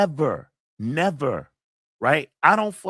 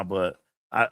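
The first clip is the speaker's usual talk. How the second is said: ordinary talk, nothing marked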